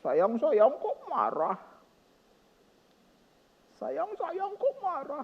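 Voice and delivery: put-on slow, high, whiny little-kid voice; imitating a small child. Father retelling his little daughter sobbing, in a slow high child voice. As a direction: plead like a crying four-year-old. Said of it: "dia bilang begini".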